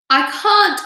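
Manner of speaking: high, loud helpless cry; despairing cry. A narrator's reading. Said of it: "cried James".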